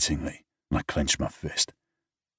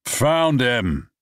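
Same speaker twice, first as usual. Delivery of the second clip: calling out; 9 semitones higher, 5 dB louder than usual. Acted. James calling out on beach.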